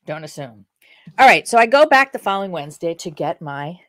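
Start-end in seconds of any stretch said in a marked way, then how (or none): 0.1-0.7 "Don't assume" dropped much softer and lower; soft repeat. Shown as a demonstration. Telling viewers the lesson again, softly. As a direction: let "Don't assume" sink in quietly. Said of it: "lesson one".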